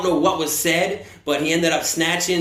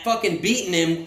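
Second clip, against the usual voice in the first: usual speaking voice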